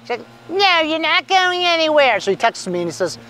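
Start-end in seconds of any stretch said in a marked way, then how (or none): 0.5-2.2 wife voice. Retold or imitated. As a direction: stretch it out high, like a disapproving wife. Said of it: "and then his wife says".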